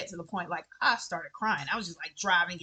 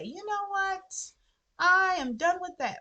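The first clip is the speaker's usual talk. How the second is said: a mock fed-up outburst, pitched way up; fed-up declaration; 11 semitones higher than usual. Imagined self fed up after trauma.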